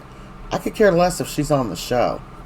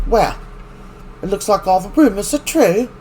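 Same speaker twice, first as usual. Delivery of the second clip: a hint of snide, higher and louder; mock smug Gwen; 7 semitones higher, 3 dB louder than usual. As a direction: sneer the gossip at them lightly. Gwen barging in, voiced by recapper.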